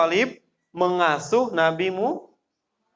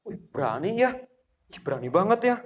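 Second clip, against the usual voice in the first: softer, awed gossiping aside; amazed murmur; 3 semitones higher, 4 dB quieter, faster than usual. Retold or imitated. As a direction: marvel at his nerve under your breath. Onlookers stunned by the claim. (bisik kagum penonton)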